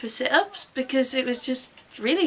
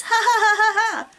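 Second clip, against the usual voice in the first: higher, louder hammed-up taunting cackle; mocking laugh; 9 semitones higher, 4 dB louder than usual. Boyfriend jeering at the heroine, loud mocking cackle. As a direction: cackle at her like a smug bully.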